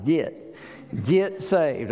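"Get" said as "git"; short i in "Get", like "git"; Southern pronunciation. Shown as a demonstration. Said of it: "we pronounce it G I T".

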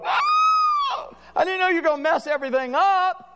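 high, squeaky mock whine; whiny protest. Believer whining to God over broken bread, squeaky mock whine. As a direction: wail like a spoiled kid losing his bread. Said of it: "We go".

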